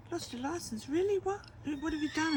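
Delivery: retelling a mother's startled reaction; shocked disbelief. Retold or imitated. A mother retells hearing about her son's license, shocked and high. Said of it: "I said".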